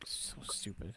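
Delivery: breathy whisper under the breath; scornful whispered "so stupid"; whispered aside. Real, not acted. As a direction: mutter it as a private aside. Muttered aside to co-host.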